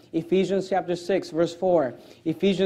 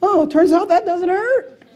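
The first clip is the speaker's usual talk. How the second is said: high, slow mock surprise from a parent who tried it; surprised parent; 11 semitones higher, 3 dB louder than usual. Preacher mimics a parent testing a spanking, high and slow. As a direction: play the dad who just discovered it doesn't hurt. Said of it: "and you're like".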